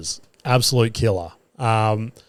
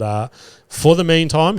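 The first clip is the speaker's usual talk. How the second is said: ordinary talk, nothing marked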